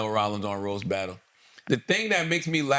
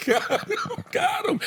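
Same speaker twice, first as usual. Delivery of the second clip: delighted squeal; 13 semitones higher, slower than usual. Real, not acted. Laughing at the roast burn.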